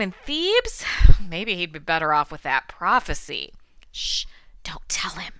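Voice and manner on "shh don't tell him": hushed, playful stage whisper; conspiratorial whisper. Pretend. Host shushes listeners about Oedipus' fate, conspiratorial whisper. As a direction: whisper it like a secret aside.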